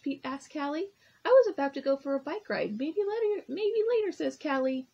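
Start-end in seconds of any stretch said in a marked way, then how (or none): 1.2-4.1 put-on breezy girl voice. Callie brushes Pete off.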